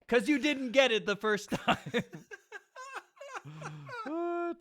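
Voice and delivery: high laughter close to tears; cracking up, near crying; laughing hard. Real, not acted. Laughing hard at listener post.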